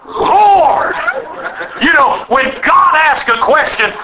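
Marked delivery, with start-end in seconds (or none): 0.1-0.9 high, drawn-out cry; Saul's cry. Retold or imitated. Saul answering God on the Damascus road, crying out high. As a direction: cry it out in surrender. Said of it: "And he goes".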